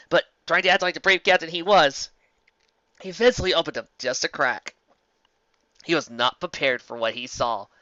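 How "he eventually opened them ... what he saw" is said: plain reading voice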